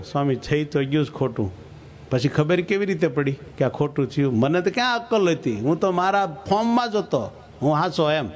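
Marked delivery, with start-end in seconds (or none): none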